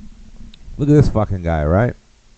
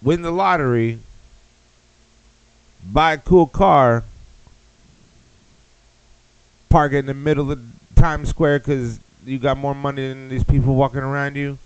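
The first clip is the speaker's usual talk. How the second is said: mock commercial; 6 semitones higher than usual. Comic reading fake sponsor copy, hammed-up ad voice. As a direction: sell it like a cheesy car ad.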